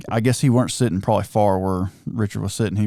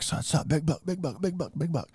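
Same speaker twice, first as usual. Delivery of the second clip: high, hushed, rapid-fire repeats; urgent hushed alert; 6 semitones higher, 8 dB quieter than usual. Retold or imitated. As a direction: excitedly alert a hunting partner under your breath. Urgently alerting son while hunting.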